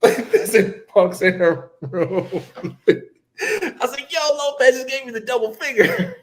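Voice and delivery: high, laughing through the words; can barely get it out for laughing. Real, not acted. To his friends about Lopez, laughing hard.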